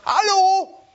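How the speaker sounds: high, loud call across a crowd. Retold or imitated. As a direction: call out to people at a distance. Jesus ruft den Leuten zu. (lauter, hoher Zuruf)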